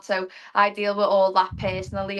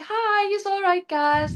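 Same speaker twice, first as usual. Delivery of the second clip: casual greeting in a much higher voice; breezy mock hello to the group, pitched way up; Jürgen Klopp; 11 semitones higher, slower than usual. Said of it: "was like".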